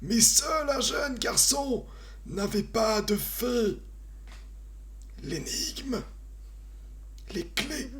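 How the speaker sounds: high, slow game-show riddler; Fort Boyard riddler voice. Acted. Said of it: "je fais plus en mode, euh, Fort Boyard".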